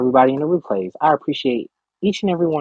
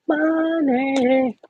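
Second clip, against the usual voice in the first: playful high money; 11 semitones higher than usual. Real, not acted. High playful drawn word.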